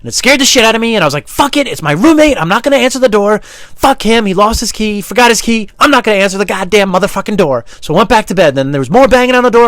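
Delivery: loud, high, agitated yelling; worked-up storytelling. Acted. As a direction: yell the story out, over-excited. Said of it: "telling the story like this".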